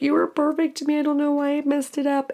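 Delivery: high, fast mocking imitation of the ex. Mimicking her ex's apology after the breakup, high mock whine. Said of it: "I remember him being like".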